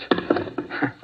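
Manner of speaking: short, almost voiceless embarrassed laugh; nervous laugh. Acted. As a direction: laugh at yourself, uneasy and brief. Nervous doctor holding a gun.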